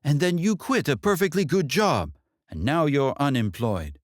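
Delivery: a hint of a scolding mother; mother's jab.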